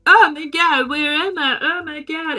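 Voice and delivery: high, put-on bewildered waking; hammed-up confused fright; mock panic. Students waking in coffins, acted.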